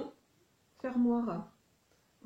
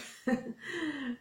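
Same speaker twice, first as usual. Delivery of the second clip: short, higher-pitched laugh; 5 semitones higher than usual. Real, not acted. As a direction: let out a quick real laugh. Rit des pieds mouillés. (petit rire aigu)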